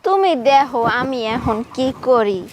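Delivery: slow, drawn-out counter-threat; deliberate, menacing retort; defiant threat. Acted. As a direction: stretch each word as a warning. Throws his threat back at him.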